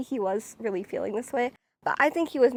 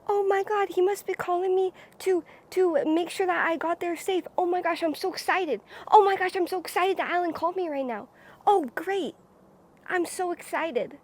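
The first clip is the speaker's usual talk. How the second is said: higher-pitched excited thinking aloud; 5 semitones higher than usual. Retold or imitated. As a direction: re-enact giddy excitement over an incoming call. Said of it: "I was very excited".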